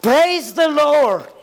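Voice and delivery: high called-out praise; slow, high mock church cry; imitating a shout. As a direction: call it out like a congregation. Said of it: "empiezan a gritar".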